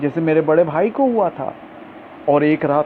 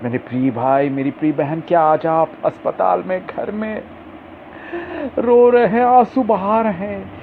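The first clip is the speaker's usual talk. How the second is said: higher, louder rising sermon cadence; impassioned preaching; 8 semitones higher, 3 dB louder than usual. Acted. Preacher calling to the grieving congregation, impassioned and higher. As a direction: preach it out to the weeping, building up.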